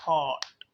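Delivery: हॉट said loud and stretched out; exaggerated wrong way. Shown as a demonstration. Teacher showing how he is not saying it, हॉट loudly drawn out. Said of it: "या मैं ऐसे बोल रहा हूँ".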